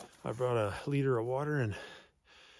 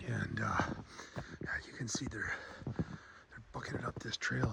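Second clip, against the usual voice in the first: hushed tracker's whisper; 6 dB quieter than usual. Real, not acted. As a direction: breathe the words so wolves can't hear. Quietly tracking wolves on trail.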